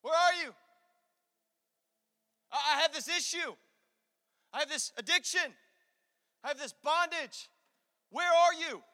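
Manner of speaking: loud, high plea flung up to God; acted desperate call, with long pauses; crying out. Preacher voicing a struggler calling to God, crying out.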